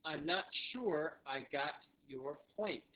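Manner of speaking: slow, evenly spaced words; careful word-by-word delivery; slow repeat-after-me model. Shown as a demonstration. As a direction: say it slowly so learners can follow. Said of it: "so one more time".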